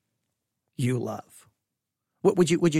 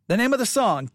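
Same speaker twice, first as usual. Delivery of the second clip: normal talking voice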